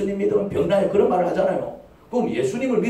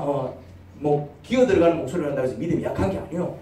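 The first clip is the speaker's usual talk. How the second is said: usual speaking voice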